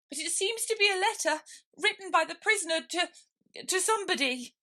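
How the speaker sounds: very high, hurried rabbit voice; flustered rabbit. Acted. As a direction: blurt it out like a nervous rabbit.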